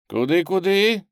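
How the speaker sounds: high, loud wary query; suspicious. A narrator's reading. Street boy questioning the stranger's offer, suspicious. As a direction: ask back distrustfully. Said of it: "с подозрением переспросил один мальчуган".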